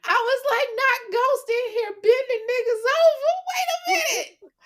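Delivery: high, loud shout through laughter; laughing shout. Real, not acted. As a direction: shriek it, cracking up. Shocked reaction, laughing shout.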